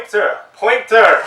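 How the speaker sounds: loud shouted one-word lecture cue; yelling professor. Retold or imitated. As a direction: bellow it like a professor drilling a point. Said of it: "He kept yelling that".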